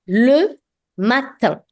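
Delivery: le matin, slow and clear, nasal end; teacher models le matin slowly for the class; French model word. Shown as a demonstration. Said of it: "Repeat after me".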